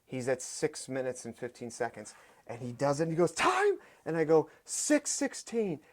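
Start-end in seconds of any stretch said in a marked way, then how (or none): 3.3-3.8 high burst of triumph; pitched-up victory cry; triumphant shout. Retold or imitated. Client finishing circuit. 4.7-5.8 slow, high, hushed in wonder; awed announcement. Retold or imitated. Announcing time, emotional.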